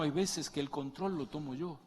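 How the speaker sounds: normal talking voice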